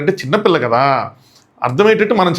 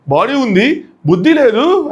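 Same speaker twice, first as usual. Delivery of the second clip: mock scolding in broken Telugu; 7 semitones higher, much slower than usual. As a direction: shout it in clumsy, halting Telugu. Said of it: "తనకు వచ్చిన తెలుగులో".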